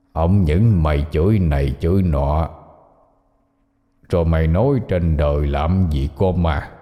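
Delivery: low, deliberate demon voice; menacing hoarse demon. A narrator's reading. Demon threatens the man who cursed it, low and menacing. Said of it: "Con quỷ cười gằn lên từng tiếng khàn khặt".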